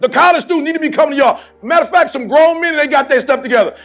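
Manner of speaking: loud, driving call to set the standard; preaching at full force. Acted. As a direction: fire up the team like a preacher in full flow. Fired-up speech to the team.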